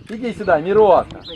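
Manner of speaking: loud call across the yard. Real, not acted. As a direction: call him over loudly. Calling the lion over.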